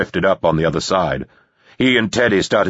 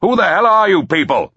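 high, raised angry shout; angry booming voice; 13 semitones higher, 4 dB louder than usual. A narrator's reading. Voice from the manor challenging swimmers, angry boom. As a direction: boom it out at intruders. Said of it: "a voice boomed out from the manor".

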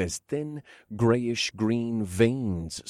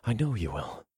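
whispering; 4 semitones higher, 7 dB quieter than usual. Acted. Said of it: "I whispered".

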